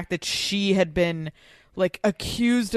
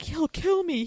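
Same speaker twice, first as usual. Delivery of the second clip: mock panic; 10 semitones higher, slower than usual. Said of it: "how Tinsley kept saying".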